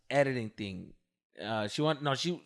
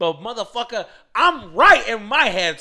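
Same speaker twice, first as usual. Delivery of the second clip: frustrated retort; 5 semitones higher, 8 dB louder than usual. Retold or imitated. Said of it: "made it more frustrating".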